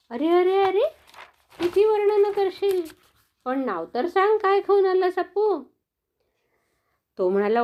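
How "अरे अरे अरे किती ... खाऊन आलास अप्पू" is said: high, loud eager questioning; high-pitched impatient animal friends begging to know; eager curious friends. Acted. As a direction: press him to tell, all excited.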